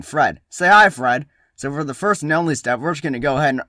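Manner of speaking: loud, punchy vlogger intro; hyped DIY YouTuber. Acted. Catalyst DIY opening a craft video for his team, hyped. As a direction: pump up the viewers like a DIY host.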